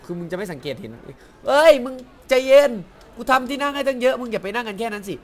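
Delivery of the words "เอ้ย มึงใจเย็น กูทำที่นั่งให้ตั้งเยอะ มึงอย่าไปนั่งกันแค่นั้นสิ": much higher, louder exasperated shout; yelling at guests. Real, not acted. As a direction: shout at the little guests to spread out. Yelling at game guests crowding seats.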